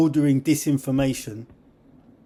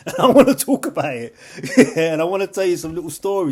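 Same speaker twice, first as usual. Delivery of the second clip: louder, laughing through the words; 4 semitones higher, 8 dB louder than usual. Real, not acted. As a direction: talk while laughing. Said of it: "laughing while talking".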